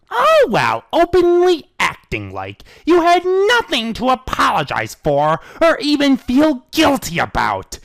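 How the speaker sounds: high, loud, slow scorn; mock her, then bear down; sarcastic anger. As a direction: brother rebuking her arrogance. Shining sarcastic then shouting.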